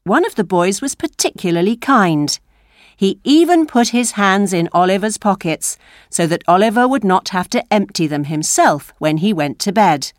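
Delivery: ordinary delivery, nothing marked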